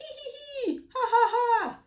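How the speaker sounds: high hee-hee rising into loud ha-ha; playful storybook laughter; acted monkey giggling. Monkey and cat's mischievous giggling.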